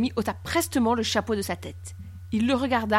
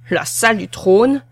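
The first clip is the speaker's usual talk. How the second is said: loud, drawn-out, rising echo; surprised question; 9 dB louder than usual. A narrator's reading. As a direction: repeat it slowly in disbelief. Captain reacts in surprise. (surpris, question forte et traînante)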